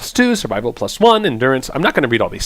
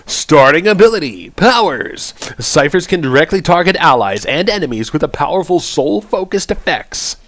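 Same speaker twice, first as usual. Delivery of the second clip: higher-pitched, hammed-up announcer; weird announcer voice; 4 semitones higher than usual. Streamer reads chanter abilities to viewers, weird announcer voice. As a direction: announce each ability in a silly raised voice. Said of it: "read my ability in my weird voice".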